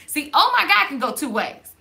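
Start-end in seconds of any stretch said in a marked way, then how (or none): 0.3-0.9 high, loud "Oh my God" full of worry; stressed oh my God. Shown as a demonstration. Vlogger demonstrating the anxious version for viewers. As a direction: blurt "Oh my God" in alarm. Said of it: "it can have stress to it".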